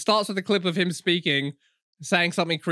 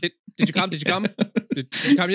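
stumbling repetition; faster than usual. Acted. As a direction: stutter on "Did, did" and rush on. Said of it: "like, stumbles over himself".

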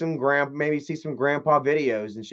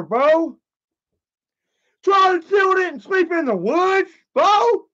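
Tina D impression; 9 semitones higher than usual. Chat requests Tina D, high brash hollering woman. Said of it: "do a Tina D impression".

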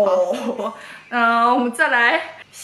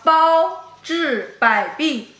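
slow exaggerated mouthing; 2 semitones higher, much slower than usual. Shown as a demonstration. Repeating phrase for lip reader.

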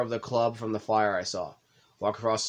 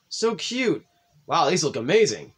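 excited; 5 semitones higher, 5 dB louder than usual. Acted. Sayori sees cupcakes.

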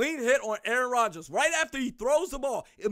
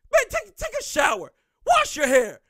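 much higher, louder jeer; scornful shout; 8 semitones higher, 5 dB louder than usual. Real, not acted. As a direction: jeer them off the stage. Telling fans off.

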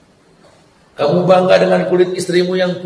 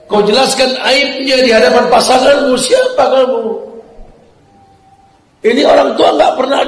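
louder and higher, fervent sermon; impassioned preaching; 4 semitones higher, 5 dB louder than usual. Acted. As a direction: preach it with rising fervor. Preaching at full force. (khotbah berapi-api, lebih keras dan tinggi)

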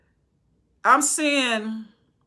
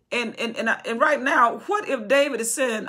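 usual speaking voice

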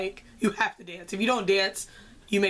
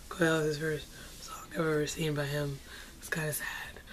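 whispered sadness; 5 semitones lower, 9 dB quieter than usual. Real, not acted. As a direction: drop to a sad whisper. Sad about missing song.

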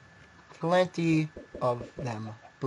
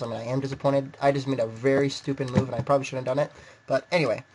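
usual speaking voice

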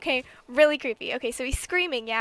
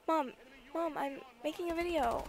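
calling to mom; 4 semitones higher, 8 dB quieter than usual. Real, not acted. Interrupted, calls to her mom.

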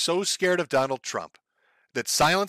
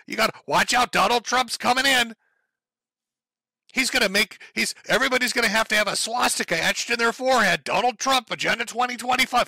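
higher mock-panicked warning; sarcastic fearmonger, pitched up; mocking alarmist; 7 semitones higher than usual. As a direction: play the hysterical doomsayer. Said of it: "fairy tales and nightmare scenario shit".

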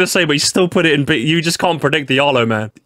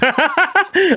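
high-pitched burst of laughter; delighted laugh; 14 semitones higher than usual. Real, not acted. Gamer gloating over a counted prediction, high laugh.

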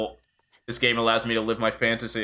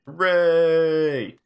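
higher, louder hooray; excited cheer; 5 semitones higher, 5 dB louder than usual. Real, not acted. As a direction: let out a big cheer. Cheering in the game.

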